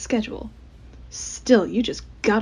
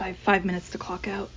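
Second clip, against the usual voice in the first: usual speaking voice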